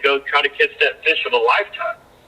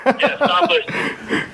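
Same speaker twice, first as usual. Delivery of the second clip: laughing; 6 semitones higher, slower than usual. Real, not acted. Echoes the host's joke, laughing.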